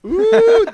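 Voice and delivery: high, loud "ooh"; big playful ooh of intrigue; excited ooh. Real, not acted. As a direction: let out a high, teasing oooh. Teasing reaction to a spoiler.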